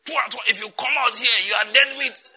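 loud barking threat with a hard edge; shouted threat. Retold or imitated. Preacher voicing soldiers threatening celebrants, loud shout. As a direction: bark it like a gunman warning the crowd.

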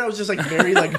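normal talking voice

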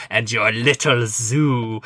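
slow sneering Nazi villain line; mustache twirling villain. Retold or imitated. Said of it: "cliche Daniel Bruhl, like, mustache twirling Nazi".

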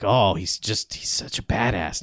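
low, soft fake fawning; mock admiration. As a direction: gush under your breath, mockingly impressed. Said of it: "celebrating him like".

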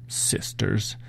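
hushed, low-energy grumble to oneself; muttered, barely voiced aside; mumbled under breath. A narrator's reading. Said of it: "David mumbled under his breath".

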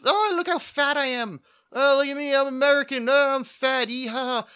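put-on high, loud caricature of a drunk reveller; hammed-up costumed yahoo, whooping it up; mock drunken American. Comic mimics drunk expats celebrating Saint Washington Day, mock drunken American. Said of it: "gets drunk on apple cider and goes".

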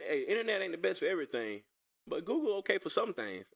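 normal talking voice

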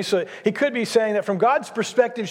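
ordinary talk

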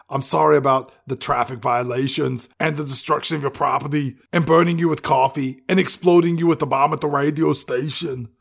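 put-on Austrian-accented action hero apologizing; imitated Austrian accent, even apology; Arnold Schwarzenegger. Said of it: "Arnold turns around and says".

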